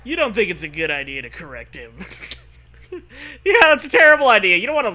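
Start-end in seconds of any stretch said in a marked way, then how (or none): 3.4-4.9 fast, loud, mocking; sarcastic. Real, not acted. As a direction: toss it off sarcastically.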